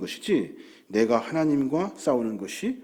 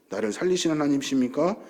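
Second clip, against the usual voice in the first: ordinary talk, nothing marked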